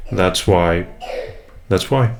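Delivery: ordinary talk, nothing marked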